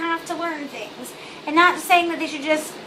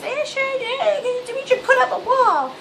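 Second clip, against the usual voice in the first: mocking voice; 8 semitones higher than usual. Mocking racist family member.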